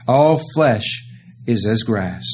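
"All flesh is as grass" said slowly and deliberately; slow it right down to drive it home; slow solemn repeat. Acted. Preacher repeats the cry.

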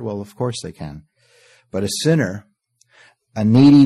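normal talking voice

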